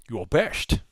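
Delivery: hammed-up Scottish accent with slushy S sounds; Sean Connery. Trader quoting a great movie to stream viewers, Scottish accent impression. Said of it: "my best impression of Sean Connery".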